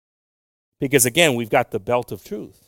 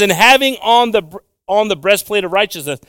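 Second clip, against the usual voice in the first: loud, raised preacher's reading; forceful, high-pitched Bible reading; scripture reading; 6 semitones higher, 7 dB louder than usual. Real, not acted. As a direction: proclaim the verse to the congregation. Reads scripture aloud to congregation.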